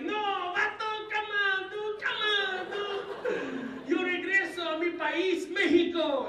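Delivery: put-on very high, pleading Mexican accent. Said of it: "I can camouflage at any moment".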